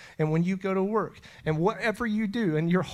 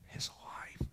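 hushed breathy whisper, almost no voice; 17 dB quieter than usual. Real, not acted. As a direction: let it fall to a whisper. Preacher breaks into whisper.

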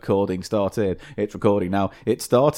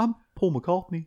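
bad Scouse accent; 6 semitones higher, 5 dB quieter than usual. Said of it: "can't do a Scouse accent".

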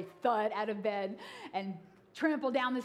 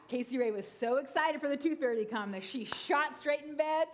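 usual speaking voice

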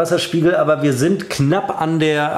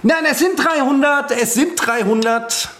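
insistent; 9 semitones higher than usual. Real, not acted. Insisting on his score.

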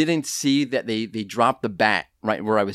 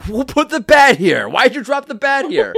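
higher, louder mock accusation; blaming the kids; 11 semitones higher, 4 dB louder than usual. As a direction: snap at the kids, half-joking. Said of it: "I blamed the children".

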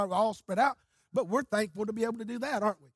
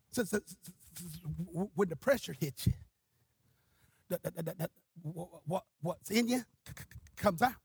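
soft, low, labored stammer; Brother Buddy's stutter; 4 semitones lower, 6 dB quieter than usual. Retold or imitated. Stuttering evangelist makes his point to the preachers, low stammer. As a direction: struggle to get each word started, low and quiet. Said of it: "He just stuttered".

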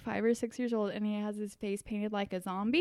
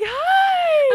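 very high, loud squeaky greeting; voice for kids; 12 dB louder than usual. Retold or imitated. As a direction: greet a small child in a super high voice. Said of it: "You're like".

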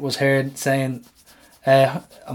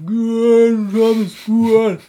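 loud, high teasing call; playful shouting; 8 semitones higher, 5 dB louder, much slower than usual. Pretend. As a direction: call out to him, egging him on. Pestering neighbour outside his house.

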